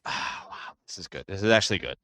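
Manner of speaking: low, soft murmur of approval; hushed, impressed. Real, not acted. Streamer impressed by the music, hushed. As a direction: say it under your breath, genuinely impressed.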